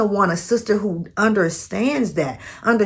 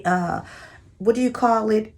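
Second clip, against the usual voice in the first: usual speaking voice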